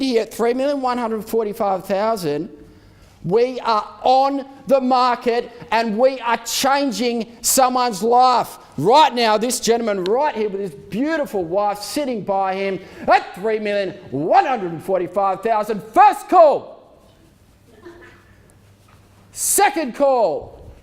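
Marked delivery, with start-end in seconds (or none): 3.2-9.4 rousing. Real, not acted. Auctioneer building up the room. 15.8-20.7 calling out. Real, not acted. Auctioneer calling then drawing out.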